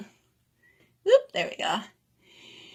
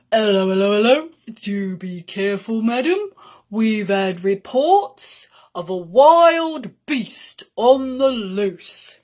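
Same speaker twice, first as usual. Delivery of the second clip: bobby policeman; 6 dB louder than usual. Acted. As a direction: warn her like a plodding village bobby. Policeman warns Nettie.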